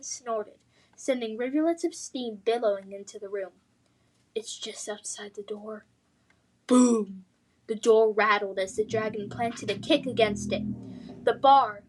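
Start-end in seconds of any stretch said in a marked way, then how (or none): 4.3-5.8 very soft frightened whisper. Acted. Dragon right outside the door. 6.7-7.3 loud booming impact word. A narrator's reading. Dragon kicking the door.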